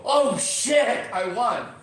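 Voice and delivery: real delighted disbelief; lottery win shout. Retold or imitated. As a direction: shout like you just won the lottery. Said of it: "when they see the lottery".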